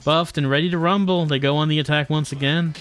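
usual speaking voice

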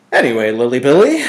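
fast, loud, playful pet name; affectionate. A narrator's reading. Father fondly teases his daughter with a nickname. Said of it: "he said affectionately".

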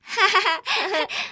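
high taunting giggle; mocking laugh. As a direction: laugh at the silly monkey. (tiếng cười chế giễu)

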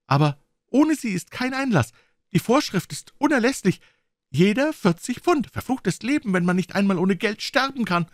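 put-on high, agitated young man's voice; verzweifelter junger Mann. (hoch und aufgewühlt)

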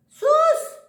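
sharp hush. Real, not acted. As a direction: hush the cat firmly. Hushing the cat.

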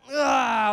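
high, loud strained ah; grudging cry while stretching; loud reluctant groan. Retold or imitated. Husband stretching the camera over, loud groan. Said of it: "where I was like".

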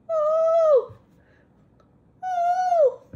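very high, loud "oh" wails; drawn-out storybook shrieks; panicked cry. Acted. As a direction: hoot in acted alarm. Acting out both characters' panic.

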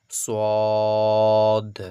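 সদ loud with a long rounded vowel; drawn-out. Shown as a demonstration. Teacher explains madd, সদ drawn out long. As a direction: stretch সদ steadily before the final d. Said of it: "টেনে টেনে পড়তে হচ্ছে".

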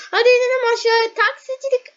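quick, loud, bouncy call; eager hurry. Real, not acted. Gamer urging viewers downstairs, fast and eager. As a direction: rush everyone along.

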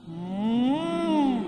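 long loud humming moo; cow moo. Shown as a demonstration. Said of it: "Let's try".

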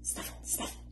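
whispered sniff; hedgehog snuffle. Acted. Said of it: "went the hedgehog".

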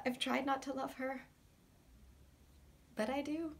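very soft, halting admission; hushed confession. Acted. Admitting a love they tried to lose, hushed. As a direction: admit it softly, pausing before the last words.

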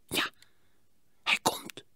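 soft excited whisper; boy whispering that the monkey is coming. A narrator's reading. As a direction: breathe it so the monkey won't hear. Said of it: "fluisterde Hielke".

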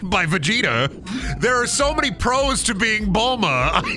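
shouted punchline. Real, not acted. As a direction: belt out the joke.

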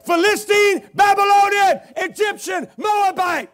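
loud high preacher's shout; fiery shout. Retold or imitated. Preacher shouting the names at the doctor.

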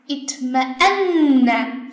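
itma'anna slow with a held nn; slow demo. Shown as a demonstration. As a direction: model itma'anna slowly for students. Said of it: "看一下".

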